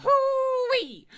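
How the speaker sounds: high, loud hoo-wee holler; excited whoop. Acted. Player whoops to start reading Starfire's answer. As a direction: whoop it out like a cowboy.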